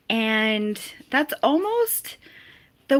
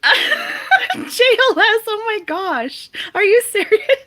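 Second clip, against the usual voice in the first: much higher and louder, squealing; excited disbelief; 12 semitones higher, 8 dB louder than usual. Real, not acted. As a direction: shriek it in delighted shock. Reacting to a viewer's joke.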